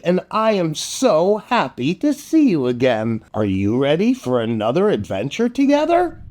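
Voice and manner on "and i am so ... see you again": usual speaking voice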